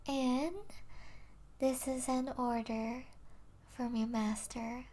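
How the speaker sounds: ordinary talk, nothing marked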